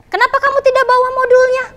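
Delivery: high-pitched acted demand; questioning teacher. Teacher asking a student about a missing module, questioning. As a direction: question the pupil, voice pitched right up.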